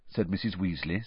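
ordinary delivery

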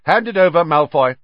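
loud, high and stern. A narrator's reading. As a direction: order it firmly. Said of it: "said Percy sternly".

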